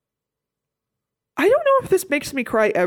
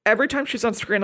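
normal talking voice

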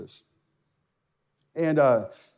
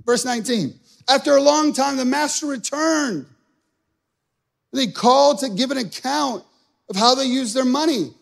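full-force preaching; 8 semitones higher, 4 dB louder than usual. Acted.

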